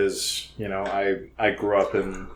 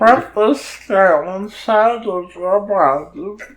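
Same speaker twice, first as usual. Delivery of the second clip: higher, slow, stretched-out delivery; high slow silly voice; 10 semitones higher, 4 dB louder, slower than usual. Pretend. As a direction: stretch each word in a high voice. Repeating a line in turn.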